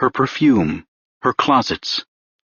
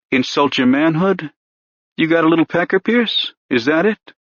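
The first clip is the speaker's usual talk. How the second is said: a hint of a needling cop, rising higher as he pushes; lightly voiced pushy detective; 3 semitones higher than usual. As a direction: coax the confession out, mockingly patient. Renner mocking Pierce.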